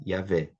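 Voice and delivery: Iavé with a short, open, stressed final vé; short-vowel pronunciation. Shown as a demonstration. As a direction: say Iavé as ia-VÉ, stressing the last syllable. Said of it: "leva uma vogal breve".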